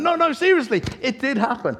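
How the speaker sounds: high, loud mock protesting; insistent protest. Storyteller convincing doubting listeners it happened, loudly insistent. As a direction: insist emphatically, voice pitched right up. Protesting to disbelieving conference audience.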